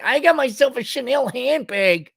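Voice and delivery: high, mocking middle-aged woman voice; mock a woman showing off her designer bag. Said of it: "with these middle-aged women like".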